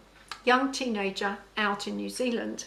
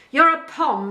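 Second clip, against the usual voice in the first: loud, higher, firm pronouncement; 5 semitones higher, 8 dB louder than usual. A narrator's reading. Farm boss labelling the new farmhand, firm. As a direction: declare it firmly, brooking no argument. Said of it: "he said firmly".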